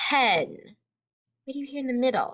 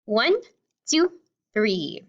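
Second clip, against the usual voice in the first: usual speaking voice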